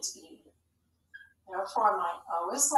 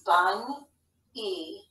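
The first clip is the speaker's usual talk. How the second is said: "bun, E" slowly, with a gap before the E; syllable by syllable. Shown as a demonstration. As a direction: sound out "bun", then "E" on its own. Teacher sounding out syllables of bunny.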